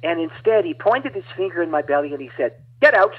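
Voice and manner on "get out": sharp, higher, louder command; preacher barking at a demon; commanding order. Retold or imitated. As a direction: cast it out with force.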